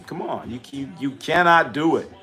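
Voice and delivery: ordinary talk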